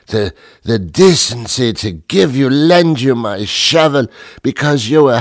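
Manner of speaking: mock-outraged British accent, building in a rant; affronted put-on British accent; indignant British accent. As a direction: scold a neighbour in a hammed-up British accent. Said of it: "we just jump right into the accent".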